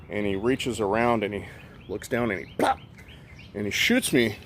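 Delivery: usual speaking voice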